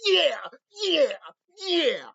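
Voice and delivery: high, loud mock agreement with a laugh. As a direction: ham up a gushing fan. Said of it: "they're like".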